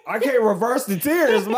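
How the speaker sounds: high-pitched mock-crying kid; hammed-up tearful complaint to a parent; mock tearful protest. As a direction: imitate a child pleading through tears. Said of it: "as the tears start rolling up".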